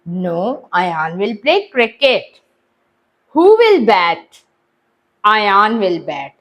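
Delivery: slow, deliberate reading aloud to pupils; measured classroom dictation; slow textbook reading. Acted. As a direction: read each word out slowly for the class. Teacher reads questions and answers.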